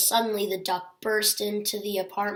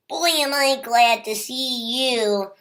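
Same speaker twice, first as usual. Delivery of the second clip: a bit louder, lightly breathless duck; breathlessly; 3 semitones higher, 5 dB louder than usual. A narrator's reading. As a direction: blurt it out as if just arrived running. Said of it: "said the little duck breathlessly".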